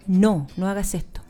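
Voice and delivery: low, steady, unhurried "No, no hagas esto"; calm firm telling. Shown as a demonstration. A mother telling her little boy no, calm and low. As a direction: set the limit gently while holding his gaze. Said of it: "mirarlo a los ojos y decirle".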